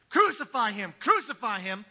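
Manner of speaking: loud chanted mob cry, hit "crucify"; crowd yelling. Retold or imitated. Preacher acting the crowd demanding crucifixion, loud chant. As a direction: shout it like a mob demanding execution. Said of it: "that was yelling fifty days back".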